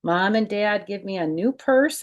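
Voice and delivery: plain reading voice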